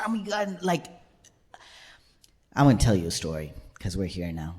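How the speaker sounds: normal talking voice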